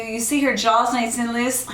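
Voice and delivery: ordinary delivery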